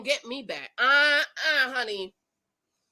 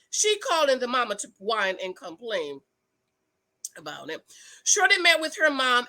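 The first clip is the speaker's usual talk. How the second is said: ordinary talk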